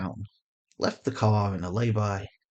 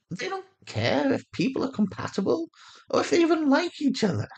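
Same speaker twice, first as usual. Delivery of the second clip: put-on higher, passionate young woman arguing hard; Sanaya's voice; 13 semitones higher than usual. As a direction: lift the voice and push the conviction.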